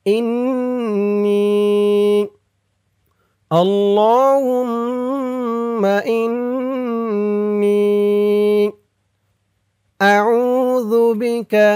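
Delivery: slow, drawn-out melodic recitation; Quranic recitation. Shown as a demonstration. Teacher reciting the dua for viewers, slow drawn-out chant. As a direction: stretch every vowel in a measured chant. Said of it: "আর আমি যেখানে যেমন টান দিব".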